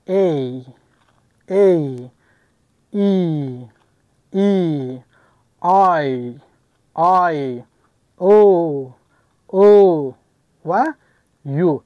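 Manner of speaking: sustained vowel names "A، E، I، O، U", each set apart; vowels from the throat. Shown as a demonstration. Teacher showing how vowels "A، E، I، O، U" flow freely, drawn out.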